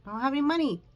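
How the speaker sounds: high, loud blurt of frustration; loud exclamation. Real, not acted. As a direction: burst out loudly, half joking. Sudden outburst about money.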